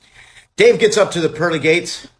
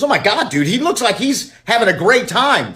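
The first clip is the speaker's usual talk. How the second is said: excited; 8 semitones higher than usual. Acted. [name] sees friend in paradise.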